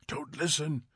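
hushed warning whisper in the ear. A narrator's reading. Faber warning Montag through the earpiece, whispered. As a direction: breathe it, barely voiced. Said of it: "whispered Faber".

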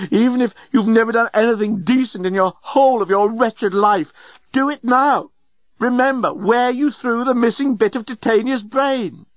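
high-pitched, desperate exhortation; impassioned cry. A narrator's reading. Journalist begs Leovinus to remember, impassioned. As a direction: drive the appeal home with frantic urgency. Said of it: "cried the journalist".

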